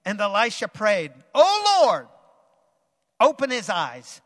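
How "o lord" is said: high loud cry to the Lord; fervent prayer. Acted. Elisha crying out in prayer, high and loud. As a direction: call out to God.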